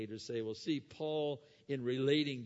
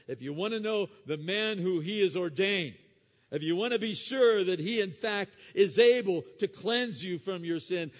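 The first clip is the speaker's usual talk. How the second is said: loud, high, ringing sermon voice; preaching at full force; 9 semitones higher, 12 dB louder than usual. Acted. Preacher urging the congregation to repent, loud and soaring. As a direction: thunder it from the pulpit.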